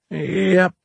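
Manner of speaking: high, drawn-out unbothered reply; old-timer's unconcerned yep; folksy shopkeeper. Acted. Plays Orlin, unbothered.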